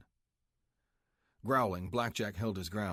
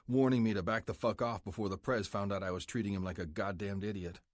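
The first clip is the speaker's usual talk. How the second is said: plain reading voice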